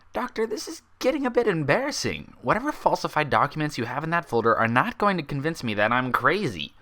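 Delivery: a sharp, disbelieving unicorn insisting she's sane; Twilight's voice. Acted.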